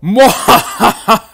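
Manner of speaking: slow booming villain cackle; evil laugh. Acted. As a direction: mwahaha like a mad scientist. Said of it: "That's my evil laugh of evilness".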